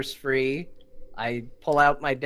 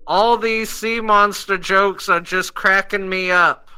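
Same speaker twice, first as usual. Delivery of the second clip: slow, loud, higher-pitched spell shout; yelling out; 6 semitones higher, 10 dB louder, slower than usual. Acted. Player casting Hideous Laughter aloud at fleeing gnome, yelling out. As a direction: yell it out slowly, every word projected. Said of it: "So I yell out".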